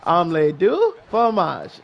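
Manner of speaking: slow, drawn-out mock French; mock French phrase. Quoting Dexter to friends, slow mock French. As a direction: savour each French syllable slowly. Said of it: "you know what that reminds me of".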